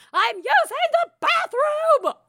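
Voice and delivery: high, loud bathroom yell; parent yelling. Retold or imitated. Imagined parent shouting from the bathroom, high and loud. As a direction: holler it like any parent in any house. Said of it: "some parent yelling".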